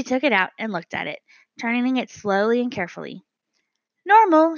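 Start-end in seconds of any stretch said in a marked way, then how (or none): 4.1-4.6 high, loud "Normal" in a bird voice; little bird reporting the thermometer; announcing bird. A narrator's reading. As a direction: announce the reading proudly. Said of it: "she announced".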